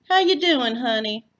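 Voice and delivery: loud, high, gushing South Texas welcome; Texas hostess. A narrator's reading. Floy greeting her uniformed guest, gushing Texas hostess. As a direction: fuss over the guest like a Texas aunt. Floy's voice.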